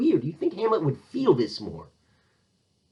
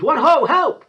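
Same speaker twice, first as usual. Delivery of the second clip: high, loud theatrical cry for help; acted scream; 13 semitones higher, 8 dB louder, slower than usual. Polonius behind the curtain hears trouble, acted scream. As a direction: yell out in mock alarm. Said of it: "Polonius screams".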